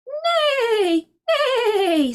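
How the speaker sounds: high, drawn-out wavering whinny; horse's neigh. A narrator's reading. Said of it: "said the horse".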